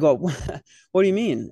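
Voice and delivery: loud, laughing question. Retold or imitated. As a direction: ask it through a laugh. Retelling his confused reply.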